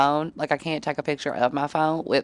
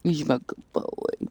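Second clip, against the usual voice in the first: slow soft cooing to a pet; 3 semitones lower, 6 dB quieter, much slower than usual. Real, not acted. Praising her cat lovingly, slow soft baby talk.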